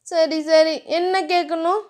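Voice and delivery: put-on flustered young wife; shy heroine. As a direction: brush him off shyly, voice lifted. Dhiya voiced higher.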